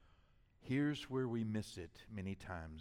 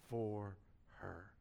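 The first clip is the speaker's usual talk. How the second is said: ordinary talk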